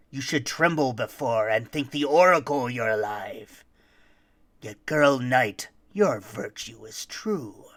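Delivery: low, stern monster voice; menacing Krampus. Acted. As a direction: growl it out as Krampus. Krampus writes his note.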